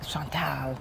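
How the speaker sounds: soft, low French pronunciation; French accent. Shown as a demonstration. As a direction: say the name softly with a French accent. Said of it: "with the French accent".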